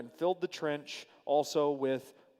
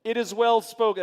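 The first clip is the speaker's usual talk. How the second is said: stilted line, higher and louder, poking fun; mock-formal; 6 semitones higher, 7 dB louder than usual. Shown as a demonstration. Preacher teases the stiff translation to his listeners, mock-formal. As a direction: say it like an odd old phrase, lifted and loud. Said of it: "This is certainly a breakdown in translation".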